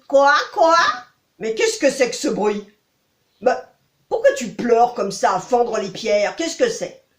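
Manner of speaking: croaking toad voice. Acted. Toad asks the crying princess why she weeps. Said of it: "elle entend une petite voix".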